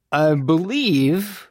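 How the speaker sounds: very slow, high, lilting drawl. A narrator's reading. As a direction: stretch it out lazily and pointedly. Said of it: "Bo drawled from the doorway".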